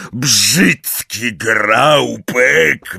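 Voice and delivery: loud, very drawn-out yell; scolding yell. A narrator's reading. Hagrid scolding the grabbing giant, drawn-out yell. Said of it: "wrzeszczał Hagrid".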